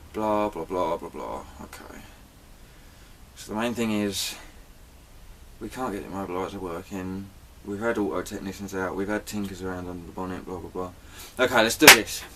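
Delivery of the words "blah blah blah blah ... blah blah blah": low, very soft rehearsal to oneself; quiet run-through. Shown as a demonstration. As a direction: run through the script under your breath. Runs through script to himself.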